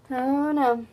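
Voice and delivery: ordinary delivery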